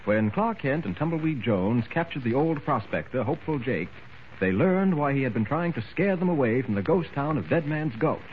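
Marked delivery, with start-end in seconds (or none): none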